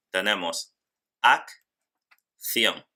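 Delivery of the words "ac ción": "ac, ción" with a long pause after ac; split into syllables. Shown as a demonstration. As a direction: sound the two Cs apart in "ac, ción". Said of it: "dos sonidos o fonemas diferentes". ("ac, ción" separado, cada ce con su sonido)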